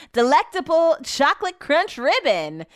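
high, swooping product-blurb voice; hammed-up ad read. Host reading ice cream description, hammed-up ad voice. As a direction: relish each word like a commercial.

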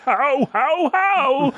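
goofy, exaggerated actor send-up; Alan Rickman. Co-host mocking the other's Rickman, high silly impression. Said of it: "Is that how I do Rickman?".

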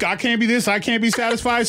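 mock picky wife. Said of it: "She just kept going up".

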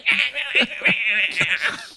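high-pitched gibbering wail; comic high wail, imitated; imitated wild wail. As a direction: mimic someone wailing as they run off. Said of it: "runs off into the woods going".

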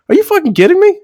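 high, outraged disbelief; mock disbelief. Johnny reacting inwardly to the salesman, high and outraged. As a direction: squeak it out in shocked outrage. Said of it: "in his mind, he's like".

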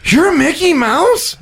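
put-on high, slow, stunned little kid; a kid gasping in disbelief; shocked toddler.

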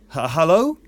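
nervous cracked call into the empty shop; a frightened hello with a catch; voice cracking. A narrator's reading. Man calling into a silent shop, voice cracking. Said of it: "his voice cracked a little in his throat".

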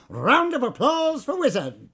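loud, high call for applause; rally the room to clap. Acted. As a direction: showman calling for applause. Manager hyping staff to cheer.

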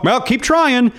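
high-pitched imitated brush-off; mock patronizing encouragement. Voices people's patronizing reply.

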